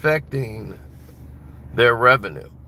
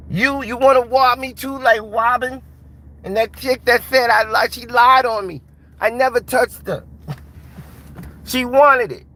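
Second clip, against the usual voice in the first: much higher, louder menacing boxer voice; Mike Tyson; 13 semitones higher, 6 dB louder than usual. Retold or imitated. Tyson threatening the man who robbed him, high and loud. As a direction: threaten him like the champ, pitched way up. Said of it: "They'd be like".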